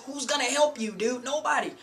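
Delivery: high, insistent warning to beginners; emphatic warning. Real, not acted. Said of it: "the reason why I'm emphasizing my words".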